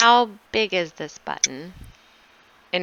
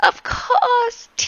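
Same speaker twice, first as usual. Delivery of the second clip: servant voice; slower than usual. Acted. Servant Anton repeats order.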